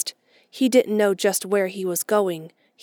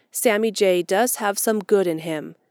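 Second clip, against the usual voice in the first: ordinary delivery, nothing marked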